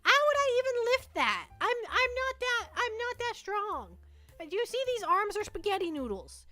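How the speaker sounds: high, exasperated whining about flippers; whiny complaint. Acted. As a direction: whine that you can't lift it.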